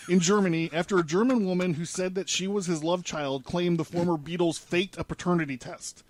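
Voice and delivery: usual speaking voice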